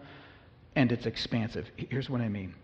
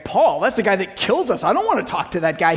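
freaking out; 5 semitones higher, 6 dB louder than usual. Acted. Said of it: "He's freaking out".

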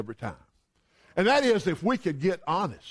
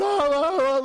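imitated sobbing, higher; mock crying wail; 6 semitones higher, 3 dB louder than usual. Upstairs daughter crying over the dress, mock wail. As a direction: fake a daughter's loud boo-hoo. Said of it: "she's crying".

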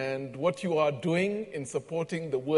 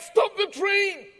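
high, slow urgent warning shout; urgent cry; 6 dB louder, slower than usual. Retold or imitated. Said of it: "these urgent words".

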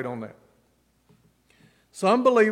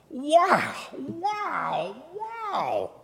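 high, drawn-out wows, the later ones softer; awed wow; 8 semitones higher, 3 dB quieter, much slower than usual. Retold or imitated. Preacher reenacting his amazement at the commentary, awed. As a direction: marvel aloud, lost in your own world.

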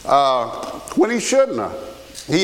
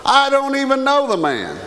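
higher, emphatic protest; sworn denial; 7 semitones higher than usual. Acted. Peter denying Jesus to bystanders, emphatic sworn denial. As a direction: insist on it as if under oath. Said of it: "this time with an oath".

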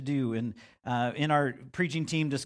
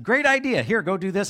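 cheerful God; 6 semitones higher, 6 dB louder than usual. Pretend. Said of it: "if he actually took my plan and said".

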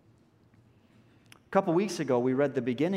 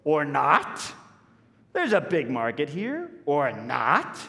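higher, louder, eager then deflating; piping up; 4 semitones higher, 4 dB louder, slower than usual. Retold or imitated. Disciple piping up with a silly idea, eager then deflating. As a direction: offer it brightly, then undercut. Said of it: "pipes up".

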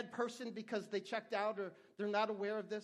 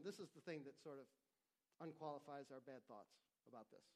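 quiet aside; 7 semitones lower, 11 dB quieter than usual. Real, not acted. Aside within scripture reading.

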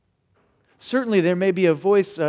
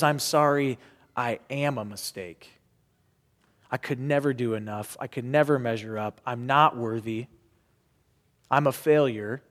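softer, lower, a defeated inner voice; quiet voice of shame; 8 semitones lower, 6 dB quieter than usual. Retold or imitated. Pastor voicing shame to the congregation, quiet and defeated. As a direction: murmur it like the shame in your head. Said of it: "That's the voice of shame".

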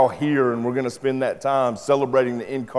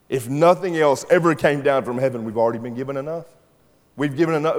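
ordinary talk, nothing marked

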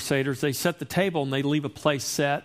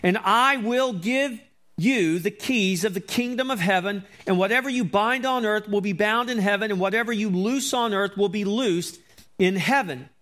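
raised, solemn preaching voice; scripture reading; 5 semitones higher than usual. Acted. Pastor reading Jesus' words to the congregation, solemn proclamation.

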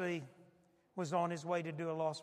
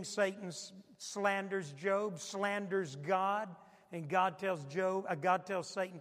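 usual speaking voice

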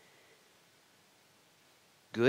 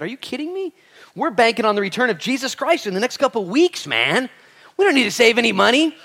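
put-on high, loud, laughing brag of young men; cocky young us; 13 semitones higher, 10 dB louder than usual. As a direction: toss it off like cocky kids sure of the end times. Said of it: "We said".

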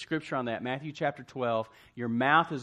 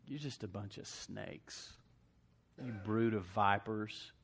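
low, soft and slow; quiet menacing rebuke; 3 semitones lower, 6 dB quieter, slower than usual. Acted. Jesus to the Pharisees, a soft, slow, menacing rebuke. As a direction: say it quiet and cold, like a threat.

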